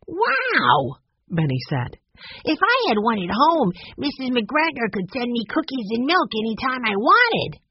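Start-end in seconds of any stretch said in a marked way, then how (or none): 0.1-1.0 put-on high, boyish amazed wow; a small boy gasping in wonder; little boy Benny, amazed. A narrator's reading. Young boy awed by a dumbwaiter, high and amazed. 2.4-7.6 put-on lightly raised boyish voice; a small boy dreaming of cookies; little boy Benny, wishful. A narrator's reading. Youngest boy imagining cookies sent to him, lightly boyish.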